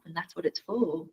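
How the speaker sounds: normal talking voice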